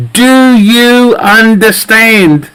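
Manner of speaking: over-articulate it like a patronising officer; mock stern officer. Acted police officer questioning citizen.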